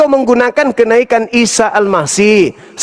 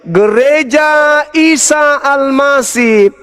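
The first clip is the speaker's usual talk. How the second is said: ordinary delivery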